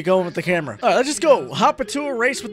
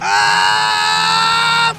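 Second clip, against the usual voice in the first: long, loud high scream; drawn-out crash yell; high "aah" held through the impact; alarmed scream; 12 semitones higher, 5 dB louder than usual. Real, not acted. Crashing into the sign.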